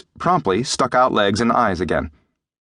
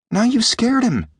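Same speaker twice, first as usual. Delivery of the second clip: put-on high-pitched boy's accusation; reproachful boy, pitched high; accusing; 14 semitones higher than usual. A narrator's reading. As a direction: accuse him in a high young voice. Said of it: "Jim said accusingly".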